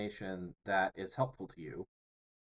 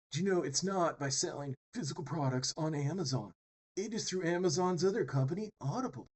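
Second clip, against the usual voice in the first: higher, put-on guru sales voice; mock YouTube pitchman; 6 semitones higher than usual. Imitating the guy on his private island, mock pitchman voice. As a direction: reveal it like a hammed-up ad guy. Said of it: "and he goes".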